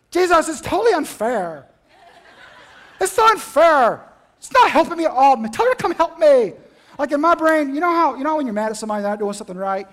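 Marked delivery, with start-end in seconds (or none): 0.1-6.5 louder, higher exasperated protest; indignant complaint. Acted. Martha complaining to Jesus about her sister, loud and indignant. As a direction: whine loudly that it's all unfair. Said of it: "it actually sounded more like this".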